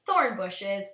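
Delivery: usual speaking voice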